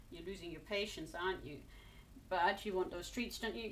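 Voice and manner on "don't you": normal talking voice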